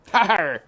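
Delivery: loud scornful har; loud jeering laugh; mocking laugh. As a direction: bark out a laugh. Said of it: "laughed Uncle".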